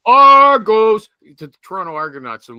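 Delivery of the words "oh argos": loud, slow drawn-out cheer of the team name; excited fan cheer. Shown as a demonstration. Host shows how he'd greet an Argos fan, with a loud, slow cheer. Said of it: "what I'm gonna say".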